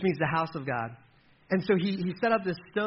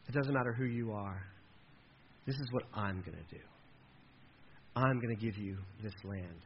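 softer, lower, unhurried comfort; tender reassurance; 6 semitones lower, 6 dB quieter than usual. Acted. God reassures Jacob he will bring him back, softly. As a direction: speak it like a gentle promise to a frightened son.

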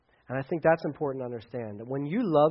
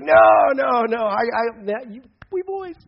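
high, loud burst of denial; loud protest; 6 semitones higher, 8 dB louder, slower than usual. Acted. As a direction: protest fast and hard, like a buddy refusing. Peter protesting to Jesus.

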